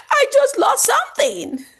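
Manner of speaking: high, loud acted wail of panic; panicking, anxious. Shown as a demonstration. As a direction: blurt it out at the top of your pitch. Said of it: "I'm panicking, I'm anxious".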